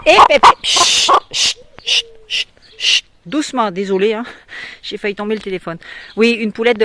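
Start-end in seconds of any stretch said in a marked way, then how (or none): 0.1-3.7 high, loud startled shushing; calming a hen. Real, not acted. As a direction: settle the flapping bird fast. Tient une poule qui s'agite. (chut pressés et aigus pour calmer la poule)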